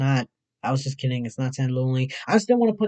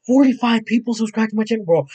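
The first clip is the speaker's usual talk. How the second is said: loud, raised with disbelief; stunned excitement; 7 semitones higher, 7 dB louder than usual. Real, not acted. Streamer telling viewers about new subscribers, excited shout. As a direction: shout it like you cannot believe it.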